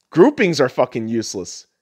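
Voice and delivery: ordinary delivery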